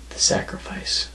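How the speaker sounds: whispered, voice gone to breath; sinister whisper. Acted. Wrestler gloating over breaking Malice's leg, hushed whisper. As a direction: savour it quietly.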